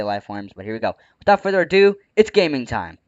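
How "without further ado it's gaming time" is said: hyped up. Acted. Hype catchphrase announcement.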